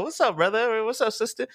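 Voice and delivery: high-pitched acted-out greeting; mock friendly greeting. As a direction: greet strangers in a put-on high voice.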